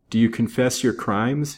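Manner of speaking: ordinary delivery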